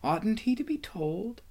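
high, softened questioning voice; earnest girl. A narrator's reading. Lucy presses Susan in secret.

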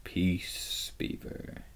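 low growled lion's rebuke; quiet, growling command. A narrator's reading. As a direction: rumble it softly, voice sinking away. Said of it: "with a very low growl".

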